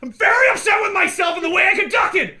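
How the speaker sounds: high, loud laughing blubbering apology; mock tearful apology. Friend imitating the wife apologizing, hammed-up blubbering. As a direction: sob out an over-the-top sorry. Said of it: "You're like".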